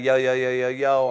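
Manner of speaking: hype greeting. Pretend. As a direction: call out like a fan greeting you. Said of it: "if you hit me with that".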